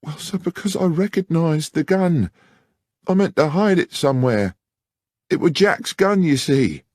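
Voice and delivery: a frightened maid pleading her case; high anxious country lass; young country woman. Acted. Narrator voices Beth Miller.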